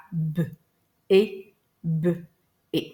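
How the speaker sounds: "B, E, B, E" as beu, é, beu, é with long gaps; four isolated sounds, beu and é alternating, for "B, E, B, E"; segmented one by one. Shown as a demonstration. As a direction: sound out "B, E, B, E" one phoneme at a time. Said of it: "je les segmente un à un".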